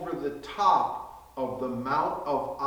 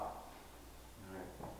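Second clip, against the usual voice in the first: ordinary talk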